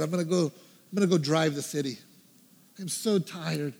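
low, soft and worn out; tired retold resignation; weary, frustrated. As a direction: sigh it out to your wife, softly. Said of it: "as frustrated as could be".

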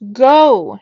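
loud high yell; desperate full-voiced yell. A narrator's reading. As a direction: shout the word at them. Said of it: "she yelled".

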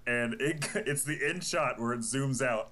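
ordinary talk, nothing marked

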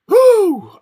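high loud whew of disgust. Real, not acted. Recoiling at the thought of touch, loud whew. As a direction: blow out a sharp squealed whew.

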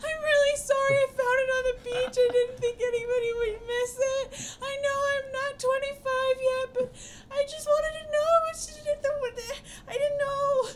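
put-on a flustered kid making excuses; Anton's sheepish plea. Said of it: "He says".

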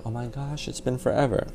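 high, soft imitation of an acquaintance; retold gushy reunion voice; mock gushing greeting.

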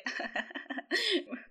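quiet giggle breaking through; suppressed laugh. Real, not acted. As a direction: let a soft laugh slip. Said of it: "Yo me río". (risa contenida)

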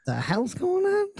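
high, slow, hammed-up disbelief; mock outraged. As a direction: play it big and baffled. Mock confronting the waitress.

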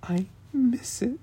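tiny pitiful voice, teasing; mock heartfelt sincerity. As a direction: mimic a friend's pained sincerity, hammed up. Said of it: "I could hear the sincerity in your voice".

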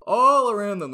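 big booming narrator opening; loud narration. A narrator's reading. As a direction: open the scene like an epic trailer. Retake of the same narration line.

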